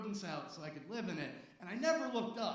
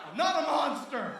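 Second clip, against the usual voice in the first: loud protest; 6 semitones higher, 8 dB louder than usual. Real, not acted. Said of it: "defending himself to laughing crowd".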